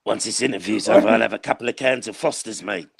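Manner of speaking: low-pitched mock Australian manager; laid-back Aussie interviewee impression; Ange. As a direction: drop low, like a downcast coach in an interview. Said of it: "Ange in interviews is like this".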